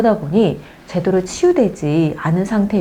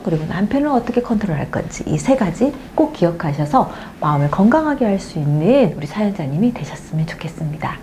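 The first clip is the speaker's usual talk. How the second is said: ordinary talk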